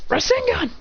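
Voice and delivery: high-pitched anime move call; mock attack call. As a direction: call out the technique as a pretend fighter.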